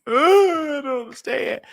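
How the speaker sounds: high, slow, sobbing complaint; crying. Retold or imitated. Walking home after no job, high sobbing. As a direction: cry it out like a baby. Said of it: "I cried like a baby".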